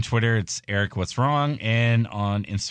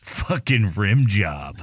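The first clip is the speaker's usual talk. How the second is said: laughing; 4 dB louder, much slower than usual. Real, not acted. Joking mispronunciation with laugh.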